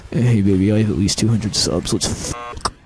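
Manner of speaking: very low, hammed-up sultry pickup line; deep seductive voice. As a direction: purr it like a cheesy lover.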